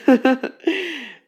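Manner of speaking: loud, bright burst of a laugh. Acted. Girlfriend laughing as she gets up, bright laugh.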